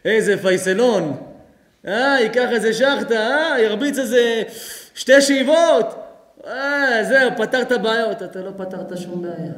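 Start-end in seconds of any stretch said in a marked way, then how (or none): 0.1-8.2 loud, higher, laughing ridicule; mocking the smoker. Preacher mocks smokers who think weed solves problems, loud and laughing. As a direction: lampoon someone who thinks a joint fixes it. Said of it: "בן אדם חושב".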